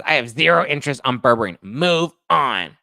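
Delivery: hammed-up impatient comment, hitting the last words hard; reading a viewer's complaint. Host reading out a bored viewer's comment, loud mock impatience. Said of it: "Mary says".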